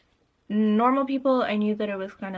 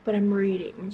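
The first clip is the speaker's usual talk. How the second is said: usual speaking voice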